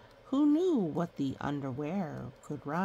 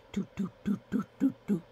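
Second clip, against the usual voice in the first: heartbeat doots; much faster than usual. Acted. Heartbeat sound in story.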